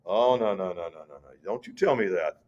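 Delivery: hushed, loving correction from God; gentle rebuke. Retold or imitated. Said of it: "rebukes him in a very gentle way".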